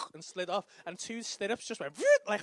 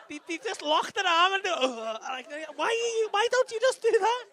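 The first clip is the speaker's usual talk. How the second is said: much higher, louder protest; outraged protest; 9 semitones higher, 8 dB louder than usual. Real, not acted. Husband protesting the rough nurse, high and loud. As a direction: cry out in disbelief.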